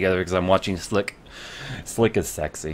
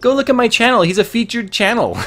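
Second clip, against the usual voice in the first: insistent; 12 semitones higher than usual. Retold or imitated. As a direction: plead that you're a fan. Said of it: "I'm like".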